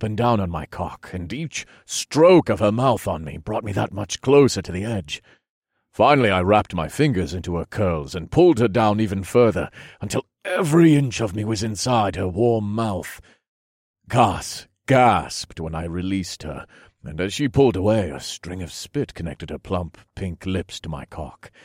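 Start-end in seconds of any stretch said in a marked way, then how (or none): none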